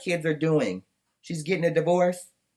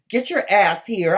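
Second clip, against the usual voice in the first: demanding call; 5 semitones higher, 6 dB louder than usual. Real, not acted. Demanding Mauricio show up.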